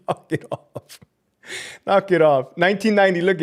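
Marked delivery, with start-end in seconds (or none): 0.1-1.0 breathy whisper through a laugh. Real, not acted. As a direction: hush it out while still laughing.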